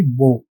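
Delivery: "bow" with the long o of "so"; "bow" rhyming with "so"; rhymes with so. Shown as a demonstration. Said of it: "It actually rhymes with so".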